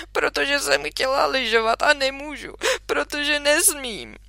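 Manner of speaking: a hint of a tearful child; lightly voiced sulky girl. Minka complaining to father, mildly tearful. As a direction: whine it like a disappointed kid.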